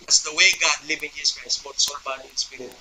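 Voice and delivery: dropping almost to a murmur; hushed. Acted. Preacher hushing the church on the triune body, very soft. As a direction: lean in and let it fade to a hush. Preacher drops to intimate point.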